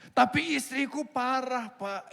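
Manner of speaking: husband begging, drawn out; whining husband. Pretend. Husband complains to God, wrong address.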